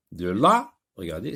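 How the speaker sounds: ordinary talk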